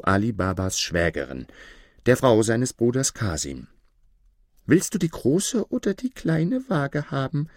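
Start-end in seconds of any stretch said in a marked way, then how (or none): 4.7-7.5 high-pitched woman character's question; inquiring sister-in-law. A narrator's reading. Sister-in-law offers a choice of scales, inquiring. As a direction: ask in a high put-on voice. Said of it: "erkundigte sie sich".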